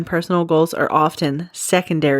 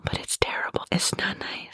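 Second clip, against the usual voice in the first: secretive whisper, much softer; 10 dB quieter than usual. Real, not acted. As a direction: whisper it so nobody overhears. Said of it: "I don't know why I whispered that".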